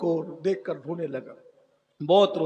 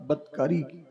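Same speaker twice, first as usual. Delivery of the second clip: normal talking voice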